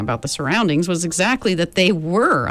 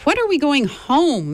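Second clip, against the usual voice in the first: anxious; 9 semitones higher than usual. A narrator's reading. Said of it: "Meg asked anxiously".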